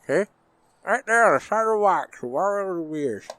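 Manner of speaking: mumbled around a cigar in mouth. Real, not acted. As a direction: talk with a cigar clamped in your mouth. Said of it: "I got the cigar in my mouth. Can't understand a fucking thing".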